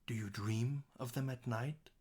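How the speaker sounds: soft, with "dream" held and "night" lifted; stress DREAM, NIGHT. Shown as a demonstration. Teacher showing which words jump out, softly stressed. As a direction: quietly draw out "dream", rise on "night". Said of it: "should jump out in that line".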